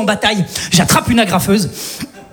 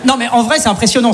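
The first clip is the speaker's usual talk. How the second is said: ordinary talk, nothing marked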